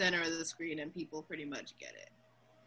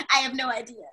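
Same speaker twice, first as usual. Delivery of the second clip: laughing; 6 semitones higher, 11 dB louder, faster than usual. Retold or imitated. Answering students, laughing.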